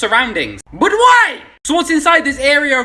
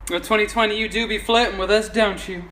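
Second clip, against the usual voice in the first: softer, lower teasing voice; sly teasing; 5 semitones lower, 6 dB quieter than usual. Acted. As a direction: coo it like a flirt. Teasing 2020 flirtatiously.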